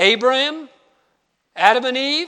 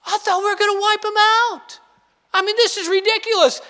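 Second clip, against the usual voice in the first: higher, fast, put-upon protest as Jonah; indignant Jonah; 9 semitones higher, faster than usual. Retold or imitated. Preacher voicing Jonah griping to God, higher and fast. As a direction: whine it like a prophet cheated of his punishment.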